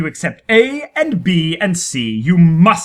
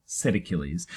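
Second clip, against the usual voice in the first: plain reading voice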